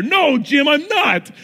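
high loud mock protest; shouting back. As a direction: yell back at the usher in fake outrage.

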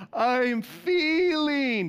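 high, loud, very slow anguished Grinch cry; Grinch screaming. Retold or imitated. Pastor imitating the Grinch's heart growing, agonized scream. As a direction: scream it stretched out like the Grinch in pain. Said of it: "He's screaming".

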